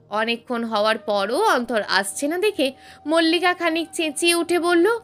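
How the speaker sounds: ordinary delivery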